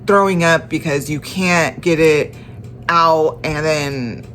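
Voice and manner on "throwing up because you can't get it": ordinary talk, nothing marked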